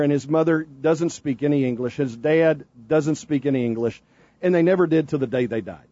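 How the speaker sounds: ordinary talk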